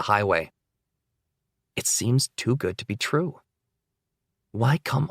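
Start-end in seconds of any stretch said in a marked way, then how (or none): none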